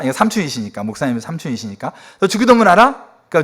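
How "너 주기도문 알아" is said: high, loud challenging question; imitated testy demand. Uncle pastor quizzing the next relative, testy. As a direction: grill them like a cross uncle. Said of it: "뭐 이렇게 막 화를 내시는 거죠".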